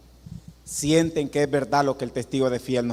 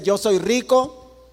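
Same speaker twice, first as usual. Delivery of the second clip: slow, drawn-out smug boast, higher and louder; mocking boast; 6 semitones higher, 4 dB louder, much slower than usual. Preacher mocking the church's proud claim, slow smug boast. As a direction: drag out the proud claim slowly. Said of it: "porque tú dices".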